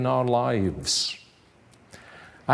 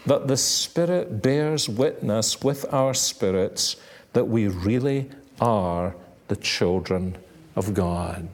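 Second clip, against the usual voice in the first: ordinary delivery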